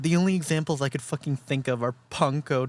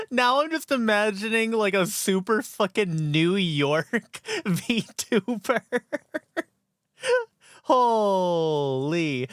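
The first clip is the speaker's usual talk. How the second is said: ordinary delivery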